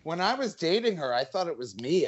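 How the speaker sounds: ordinary talk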